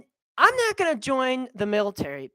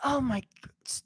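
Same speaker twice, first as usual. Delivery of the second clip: normal talking voice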